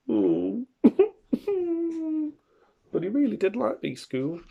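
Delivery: usual speaking voice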